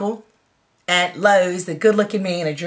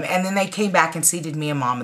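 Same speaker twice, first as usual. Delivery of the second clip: normal talking voice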